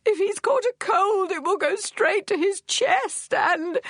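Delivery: high, tearful, near-hysterical wail; shrieking hysterics. Acted. Frantic mother on the phone, wailing in tears. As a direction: sob it out, panicking over the missing son. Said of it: "When the shrieking had died away".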